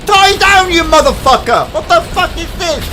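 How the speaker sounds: furious shout. Real, not acted. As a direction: yell it at the boss with everything. Screaming at boss fight.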